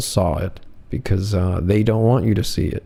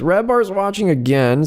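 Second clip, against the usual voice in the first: mock heckler; 8 semitones higher than usual. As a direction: drag the heckle out, much higher than normal. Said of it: "and he goes".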